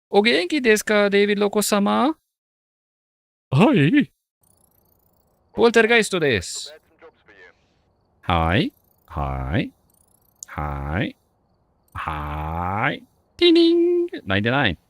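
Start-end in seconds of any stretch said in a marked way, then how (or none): 0.1-6.7 high, playful put-on Japanese; high mock-polite Japanese voice; mock Japanese ghost greeting. 13.4-14.1 high sung bell chime; high ding-ding chime; game-show ding. Pretend.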